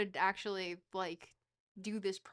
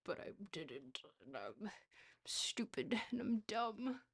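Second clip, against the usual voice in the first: soft, near-whispered self-blame; hushed self-reproach; 7 dB quieter than usual. Real, not acted. Streamer berating himself after a slip, hushed self-reproach. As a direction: say it quietly to yourself, half whispered.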